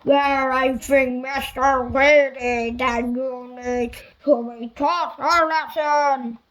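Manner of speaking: slow, loud giant voice; a stern giant scolding slowly. A narrator's reading. Giant speaks to Mister Weasley.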